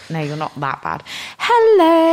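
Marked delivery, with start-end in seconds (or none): 1.4-2.1 loud hello. Real, not acted. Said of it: "podcast greeting".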